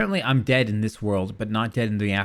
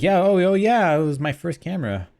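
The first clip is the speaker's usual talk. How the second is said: fake polite enthusiasm; 6 semitones higher, 4 dB louder than usual. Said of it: "you can kinda see them being like".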